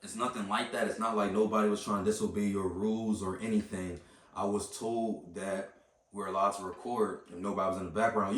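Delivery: low, soft, even voice; calm. Retold or imitated. Customer retelling his calm explanation at the desk, low and calm. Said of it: "So then I calmly get up".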